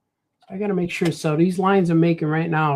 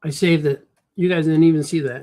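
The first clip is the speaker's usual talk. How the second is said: ordinary talk, nothing marked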